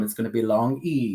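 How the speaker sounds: ordinary talk, nothing marked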